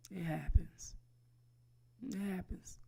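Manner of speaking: mock-sympathetic whisper; hushed, pitying whisper; consoling whisper. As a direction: whisper it like a fake comfort.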